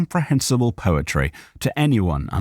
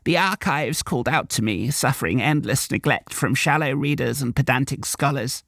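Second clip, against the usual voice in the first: put-on old woman; 6 semitones higher than usual. A narrator's reading. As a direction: lament like an ancient keeper. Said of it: "the old woman croaked".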